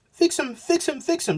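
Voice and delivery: very high panicked cry; mock panicked wife crying for her husband; panicking. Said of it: "she's panicking".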